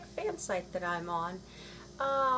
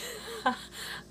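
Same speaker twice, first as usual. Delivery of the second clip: breathy laugh; 13 semitones higher, 6 dB quieter than usual. Real, not acted. Laughing at a school memory.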